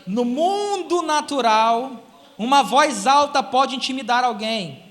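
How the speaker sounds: slow, deliberate teaching line; slow emphatic. Shown as a demonstration. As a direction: lay the point down slowly. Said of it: "emphatic teaching point".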